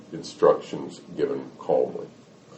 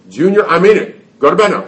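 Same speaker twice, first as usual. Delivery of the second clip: much louder, higher exasperated mom; stern warning; 10 semitones higher, 9 dB louder, faster than usual. Acted. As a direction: snap a firm order at the child. Mother's warning escalates.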